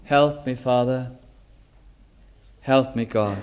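soft, low pleading murmur; inner cry for help. Acted. Preacher voicing a lonely woman's prayer, softly pleading. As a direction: plead quietly from deep in the heart. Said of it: "there's a cry".

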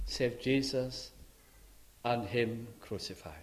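ordinary delivery

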